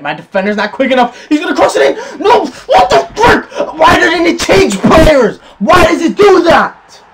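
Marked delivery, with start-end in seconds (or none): 1.3-7.0 high, loud, slow laughing outrage; real exasperated yelling, laughing; frustrated outburst. Real, not acted. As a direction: rage at the game controls. Controls fail during attack.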